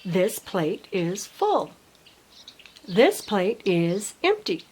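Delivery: put-on slow, careful sentence for a young child; slow modelling voice. Shown as a demonstration. Parent modelling a sentence for a child, slow and clear. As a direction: lay each word down slowly and clearly. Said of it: "you can model that for them".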